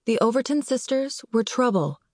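ordinary delivery, nothing marked

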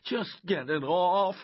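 high, loud, drawn-out plea; panicked plea. Acted. Boy begging Coach to remove a wasp, panicked. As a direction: beg urgently for it to be taken off.

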